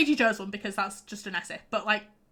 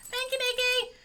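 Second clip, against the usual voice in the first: much higher, mock-grateful call; sarcastic thanks; 13 semitones higher, slower than usual. Real, not acted. Streamer thanking Nikki for the interruption, sarcastic high call. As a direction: sing out a fake thank-you.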